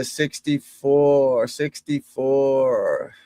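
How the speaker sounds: drawn out. Real, not acted. Reading scores slowly.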